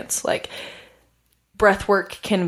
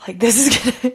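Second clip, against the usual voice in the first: laughing through it; 4 semitones higher, much faster than usual. Retold or imitated. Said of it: "And I was like".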